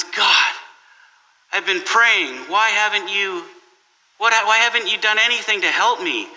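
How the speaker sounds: mild mock outburst at heaven; exasperated plea. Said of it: "he's exasperated".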